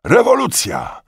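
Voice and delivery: high, loud exclamation; shouted news. A narrator's reading. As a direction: cry out great news. Returning soldiers cry out news. (głośny, wysoki okrzyk)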